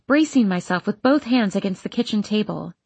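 usual speaking voice

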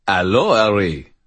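put-on lilting French-accented greeting. A narrator's reading. Brother greets Harry from behind, French accent. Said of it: "said in his French accent".

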